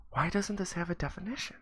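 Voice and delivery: put-on high, soft puzzled kid voice; puzzled little boy. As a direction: ask it as a baffled child, pitch lifted. Confused boy reads dictionary.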